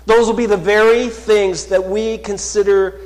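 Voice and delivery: loud, high, rising sermon voice, slowing on key words; fervent pulpit proclamation; full-force preaching. Acted. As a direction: preach it out to the whole church. Preaching promise of victory.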